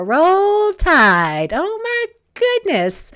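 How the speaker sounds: high, drawn-out Alabama fan cheer; excited cheer. Real, not acted. Host cheers a viewer from Tuscaloosa, excited. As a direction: stretch it out high with delighted excitement.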